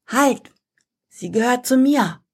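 high, firm command. Acted. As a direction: order them to stop at once. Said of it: "befiehlt Daniels Stimme".

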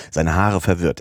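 ordinary delivery

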